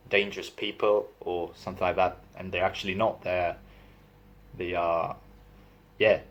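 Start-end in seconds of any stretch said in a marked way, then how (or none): none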